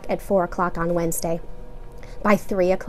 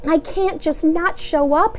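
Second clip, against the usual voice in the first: pleading; 8 semitones higher than usual. A narrator's reading. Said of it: "he pleaded".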